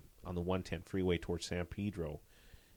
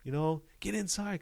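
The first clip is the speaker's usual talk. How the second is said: retold police yell; 12 semitones higher than usual. Officer retells warning bystanders during the chase, mock yelling. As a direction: imitate an urgent shout without raising the volume. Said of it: "the whole time I'm yelling now".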